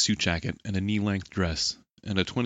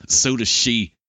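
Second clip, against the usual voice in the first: louder, higher-pitched comeback; sharp retort; 5 semitones higher, 8 dB louder, slower than usual. A narrator's reading. Kenta answering Daichi's boast of muscle, retorting loudly. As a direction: retort forcefully, raising pitch and volume. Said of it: "Kenta retorted".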